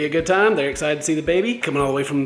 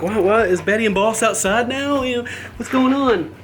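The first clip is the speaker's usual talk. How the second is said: raised-pitch mock questioning; imitating curious viewers; 7 semitones higher, 3 dB louder than usual. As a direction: mimic puzzled viewers asking. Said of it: "people have been asking lately".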